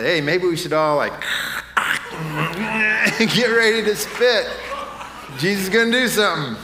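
loud, high, hammed-up excitement with laughter; eager disciples. Disciples urging each other to get ready, comically eager. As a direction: play keen followers, half laughing. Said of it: "the disciples didn't come along and say".

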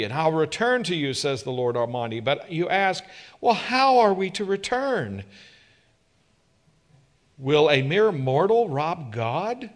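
loud, high, fervent pulpit voice; a preacher building to full force; full-force preaching. Acted. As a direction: preach it out to the whole room.

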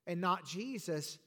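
ordinary talk, nothing marked

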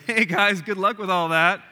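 hammed-up nonchalant wave-off; mock casual Jesus. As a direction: toss it off like a careless passerby. Mock Jesus strolling past disciples.

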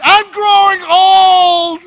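high, slow mock scream; hammed-up road-rage cry. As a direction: wail it at the red light. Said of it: "screaming at that light".

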